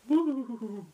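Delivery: high, wavering ghostly hoot; mock-mysterious sound effect, drawn out high; spooky "woo". Spooky noise after mysteriously.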